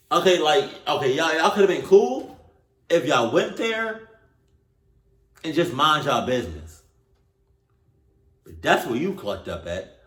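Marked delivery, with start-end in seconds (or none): 0.1-6.8 high, loud, fed-up telling-off; exasperated scolding. Acted. Vlogger scolding the teens from the story, loud and exasperated. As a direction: scold them like a fed-up aunt.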